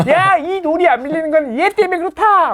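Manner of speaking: very high, comically strained complaint; mock outrage. Failed rock-pusher blaming another for the stuck rock, mock outrage. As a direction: blame someone in a hammed-up high voice.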